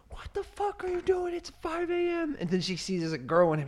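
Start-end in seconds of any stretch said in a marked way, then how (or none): 0.1-2.4 high whispered mom shriek; mock the mom bursting in, pitched way up. A son mimics his mom's high whispered outburst. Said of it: "She's like".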